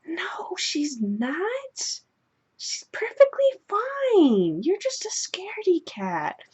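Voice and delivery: put-on very high, drawn-out whispery kid voice; squeaky stage whisper of a dismissive brother; little boy voice. Brother brushing off his scared sister, high whispery child voice. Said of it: "he goes".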